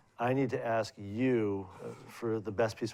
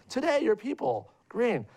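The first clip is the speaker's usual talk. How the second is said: put-on higher-pitched, louder old landlady voice; elderly landlady; 13 semitones higher, 3 dB louder, slower than usual. Old landlady scolding her tenant about green, pitched up and louder.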